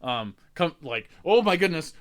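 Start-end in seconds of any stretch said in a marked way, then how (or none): 1.2-1.9 startled elf. Retold or imitated.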